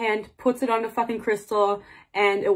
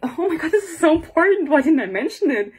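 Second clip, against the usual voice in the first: flustered realization; 6 semitones higher, 5 dB louder than usual. Real, not acted. She forgot a key plot point.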